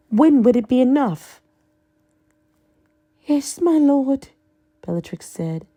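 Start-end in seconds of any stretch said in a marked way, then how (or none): 3.3-4.3 high, adoring deference. Acted. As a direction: gush it devotedly. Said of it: "deferential and adoring".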